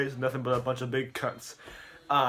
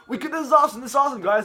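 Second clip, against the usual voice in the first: high, loud mock excitement; acted celebration; 12 semitones higher, 9 dB louder, much faster than usual. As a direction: cheer it out, playing overjoyed. Acted celebration of millions of views.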